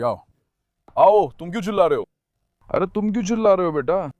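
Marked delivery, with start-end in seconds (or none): none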